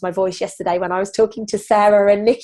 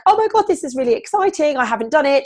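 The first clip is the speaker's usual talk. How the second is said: much higher squeal of excitement; excited friend; 6 semitones higher, faster than usual. Retold or imitated. Coach imitating Nikki's excitement, high and squealing. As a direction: quote her thrilled teammate Nikki, high and bright-eyed. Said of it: "And she was like".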